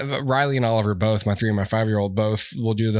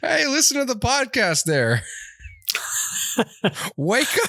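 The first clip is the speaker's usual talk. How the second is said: much higher chirpy Canadian call; mock Canadian accent; 11 semitones higher than usual. Host urging listeners in high mock Canadian. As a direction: holler it like a friendly Canuck.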